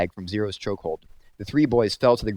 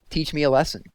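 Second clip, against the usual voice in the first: challenging; 7 semitones higher, 4 dB louder than usual. A narrator's reading. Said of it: "he challenged".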